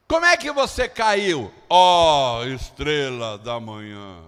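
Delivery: mocking question that sinks into a slow, low drawl; ironic. God mocking the one who fell, ironic. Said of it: "E aqui não é diferente".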